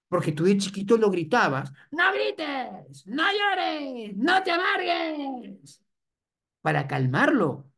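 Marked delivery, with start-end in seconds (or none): 1.9-5.8 high, shrill-free mock parent, slowing down; mock scolding parent. Parent scolding the crying toddler, high mock nag.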